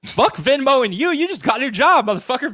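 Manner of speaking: high-pitched joking indignation; fake-offended ribbing of a buddy; playful fake anger; mock outrage.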